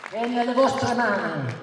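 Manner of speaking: loud, high rally shout; booming balcony speech. Real, not acted. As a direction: proclaim it to the whole square.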